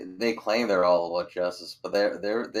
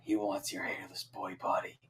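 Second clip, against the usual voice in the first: whisper; 9 dB quieter than usual. Acted. Player whispering a lewd aside to Tao, whispered. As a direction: whisper it for one person's ears. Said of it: "and whisper".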